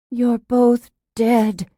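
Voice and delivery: shaky, slow and frightened. Acted. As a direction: say it trembling. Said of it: "she said shakily".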